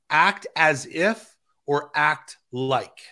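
slow, deliberate dictation with gaps; slow for note-taking. Real, not acted. Speaker giving listeners prompts to write down, said slowly. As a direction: spell out each phrase slowly for note-takers. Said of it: "I'll say this slow so people can write it down".

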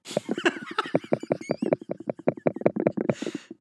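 wheezing silent high laugh; high breathless laugh. Real, not acted. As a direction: laugh helplessly, almost silent. Laughing at roast joke.

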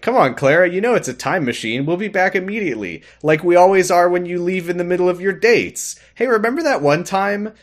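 high, needling mock wheedle; teasing persuasion. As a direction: cajole with a needling lilt. Playing the Doctor needling Clara.

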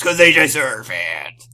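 put-on crank voice; lightly voiced ranting reactionary; mock zealot. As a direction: say it as a joke bigot, hamming it up. Sarcastic mock misogynist explanation.

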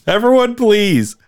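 high, loud stadium announcer. Pretend. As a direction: boom it out over the arena. Arena announcer addressing the crowd.

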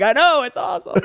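squeaky high, gleeful; squealing delight; giddy mock cheer. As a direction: chirp it brightly. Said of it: "I'm like".

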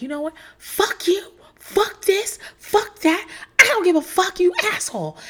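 high-pitched mock tirade at the husband; retold screaming rant. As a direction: hurl each curse high, as if screaming. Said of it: "where I'm just screaming".

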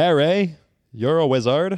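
high, badly faked British accent. Shown as a demonstration. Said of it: "That's my British accent".

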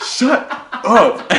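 high, loud imitation of a fed-up player; hammed-up exasperated outburst; mock angry yell. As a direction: yell it back at a heckler, half laughing. Retold player snapping at a heckler.

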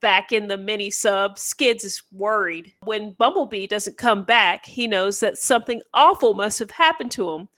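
ordinary talk

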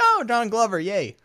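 high-pitched retold delight, easing off; pleased exclamation, starting high; delighted cheer. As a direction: cheer it like a happy fan. Retelling own delighted reaction at film.

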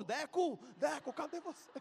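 put-on high-pitched kid calling a friend. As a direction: call out searching for your protector. Boy calls out for his friend.

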